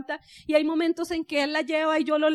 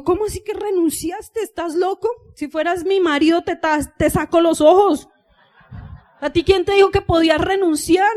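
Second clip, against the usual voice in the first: mock furious scolding; 8 dB louder than usual. Wife berating friend for quitting his job, mock furious.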